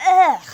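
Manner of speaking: loud, high groan of disgust; loud disgusted ugh. A narrator's reading. Artie reacts to the monster's plan, loud disgusted groan. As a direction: spit out a big ugh. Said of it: "says Artie".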